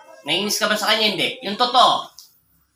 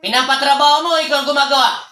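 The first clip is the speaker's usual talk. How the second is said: loud, higher accusing voice; forceful accusation; 6 semitones higher, 4 dB louder than usual. Real, not acted. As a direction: press the accusation hard. Healer accuses spirit of witchcraft. (malakas na paratang)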